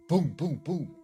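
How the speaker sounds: three high, punchy booms; imitated banging. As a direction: mimic sudden pounding on a window. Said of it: "his father is banging on the window".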